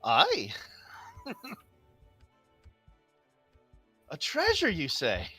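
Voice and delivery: a greedy, intrigued barbarian; barbarian woman voice. Acted. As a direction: pitch leaps up on treasure. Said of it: "It's like".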